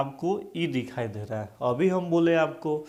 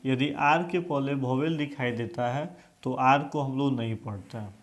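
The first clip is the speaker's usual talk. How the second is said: ordinary delivery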